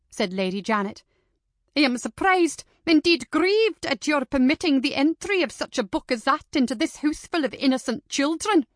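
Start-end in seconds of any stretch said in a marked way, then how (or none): 1.8-8.6 louder and higher, every word condemned; indignant denunciation. Acted. Lady Janet denounces the book to Missus Chambers, loud and indignant. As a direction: hammer each charge home.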